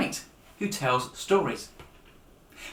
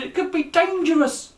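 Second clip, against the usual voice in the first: shouted warning; 13 semitones higher, 5 dB louder than usual. Acted. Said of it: "she shouted".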